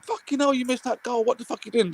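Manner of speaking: high exasperated shout; berating your own striker; mock fury at a miss; ranting fan. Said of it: "I'm like".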